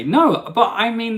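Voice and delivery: ordinary delivery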